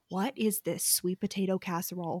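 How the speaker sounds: hushed, breathy disbelief at the slander; whispered outrage. Retold or imitated. Reader whispering back at the book character, scandalized.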